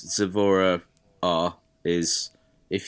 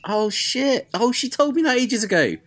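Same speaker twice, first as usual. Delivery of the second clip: surprised; 4 dB louder than usual. Real, not acted. Just realised he forgot news.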